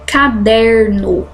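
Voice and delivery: "Caderno" slow, leaning on DER; drawn-out "Caderno" with a strong middle syllable; stressed syllable shown. Shown as a demonstration. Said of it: "identifico essa sílaba forte".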